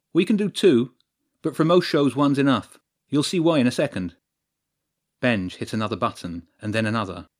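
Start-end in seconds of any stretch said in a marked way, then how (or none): 0.1-4.2 louder, lifted techie patter; keen lighting technician. Acted. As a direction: show off the gear with relish.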